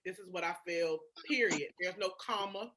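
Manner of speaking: ordinary talk, nothing marked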